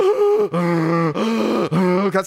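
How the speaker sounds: imitated wheezing gasps for air; winded gasping. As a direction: act out having the wind knocked out. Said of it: "I'm breathing like this".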